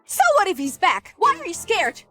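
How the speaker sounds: high-pitched indignant challenge; defiant retort. Acted. Madam on hearing the Marquis is back, defiant retort.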